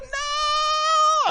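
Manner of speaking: long, loud, high acted-out wail; screaming and crying. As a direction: stretch it into a terrified scream. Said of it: "I was screaming and crying".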